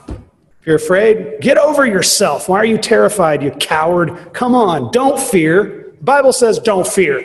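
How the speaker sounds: preacher imitating a scolder with no compassion; self-righteous rebuke. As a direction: lecture the scared person from a high horse. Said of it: "They rebuke people with no compassion in a very self-righteous way".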